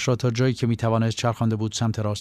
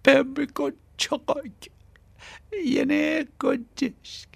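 much higher and very slow, voice worn from screaming; a terrified captive croaking out his name; frightened, strained thin voice; scared hoarse boy; much slower than usual. Acted. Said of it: "با هراس و صدایی که از فرط فریاد و جیغ گرفته بود".

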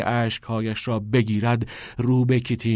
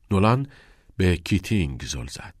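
plain reading voice

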